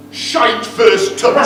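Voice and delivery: Shite First Touch said slowly and loudly, every word weighted; punchline delivered slowly. Acted. Father reveals the groom's football nickname, slow and loud.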